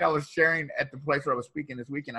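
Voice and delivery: ordinary delivery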